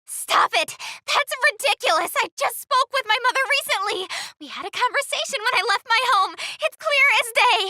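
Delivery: loud, outraged yelling at him; furious shouting. Acted. Adventurer shouts at the false god in outrage. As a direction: hurl every line at the so-called god.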